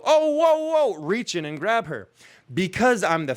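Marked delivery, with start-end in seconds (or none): 0.1-1.0 high, loud alarmed whoa; startled whoa. Retold or imitated. Man lunges to catch girl sliding backwards, high startled whoa. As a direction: yelp it in sudden alarm. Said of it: "decide to go".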